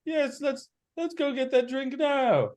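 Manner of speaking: eager character voice. Acted. Game master voices a character agreeing to a drink, high and eager. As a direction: pitch it right up and agree eagerly.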